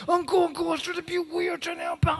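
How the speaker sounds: high, pleading nephew; whining nephew. Retold or imitated. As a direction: complain to uncle in a high whine. Nephew complaining to uncle.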